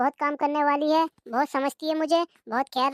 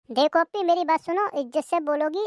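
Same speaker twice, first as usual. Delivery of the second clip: normal talking voice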